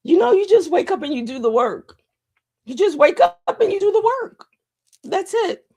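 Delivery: high, choked-up voice, fighting back tears; real tearful voice. Real, not acted. Host overcome with emotion thanking supporters, tearful. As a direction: voice climbs as the tears come. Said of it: "I'm crying literally live".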